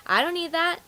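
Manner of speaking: high-pitched dismissive customer; mock scoffing. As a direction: imitate a customer brushing off the sale. Customers scoffing at warranty offer.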